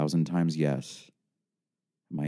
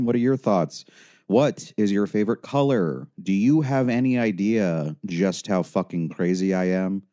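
raised, exaggeratedly lilting questions; agitated, sarcastic outburst; mocking sarcasm; 3 semitones higher, 4 dB louder than usual. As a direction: fire back mock small talk at the helper. Amused caller mocks the counsellor's question.

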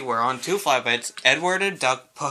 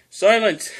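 higher, loud one-word order; commanding cry; 8 semitones higher, 7 dB louder than usual. A narrator's reading. Sir Topham Hatt hushes cheering engines, crying out. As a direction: cut through the cheering. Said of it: "cried Sir Thomas".

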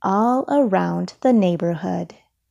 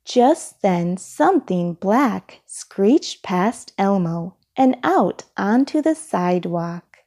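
ordinary delivery, nothing marked

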